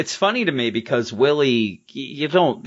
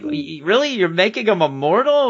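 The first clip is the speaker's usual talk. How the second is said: high, disbelieving question; can't believe what he's hearing; incredulous; 8 semitones higher than usual. Retold or imitated. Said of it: "he's like".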